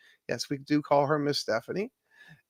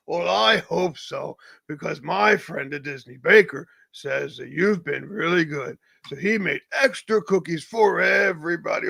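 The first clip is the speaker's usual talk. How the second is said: jolly Santa with booming ho ho ho laughs; Santa Claus voice; 3 dB louder than usual. Acted. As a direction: play a cheeky Santa teasing the kids about cookies. Plays Santa greeting kids.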